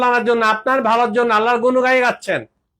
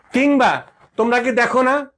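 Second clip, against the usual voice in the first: normal talking voice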